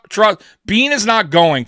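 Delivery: ordinary talk, nothing marked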